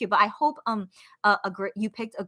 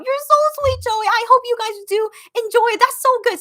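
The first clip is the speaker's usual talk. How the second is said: high, loud, sweet gushing; gushing delight; 12 semitones higher, 6 dB louder than usual. Real, not acted. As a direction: gush over a fan's kind words, voice pitched up. Gushing at a fan's comment.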